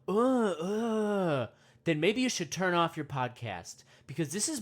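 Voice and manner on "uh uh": monkey grunts. Pretend. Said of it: "if you don't want me going".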